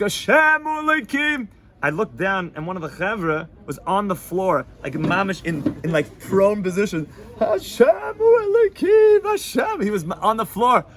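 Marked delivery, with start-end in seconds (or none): 0.0-1.5 high, slow shouted prayer; screaming prayer. Retold or imitated. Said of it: "we're all sc". 7.4-9.8 very high, loud, slow prayer scream; ecstatic scream. Retold or imitated. Man on floor screaming prayer.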